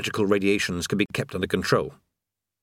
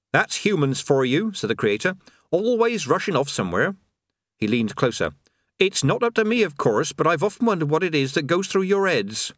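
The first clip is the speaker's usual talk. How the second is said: put-on higher, breezy voice of a chatty old workman; chatty creator; 5 semitones higher than usual. Creator chatting idly beside a falling man. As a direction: natter on as if all is fine.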